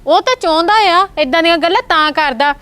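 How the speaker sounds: loud pleading warning. Acted. Sister warns brother and wife.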